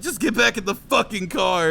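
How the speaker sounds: fed up, order everyone back in the car; mild angry yell. Retold or imitated. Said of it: "I was so mad".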